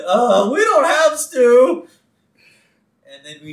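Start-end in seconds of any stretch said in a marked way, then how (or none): 0.1-1.9 put-on high, loud, slow teen voice; pimple-faced kid. Pimple-faced drive-thru kid answers customer, awkward teen voice. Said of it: "the pimple-faced kid inside".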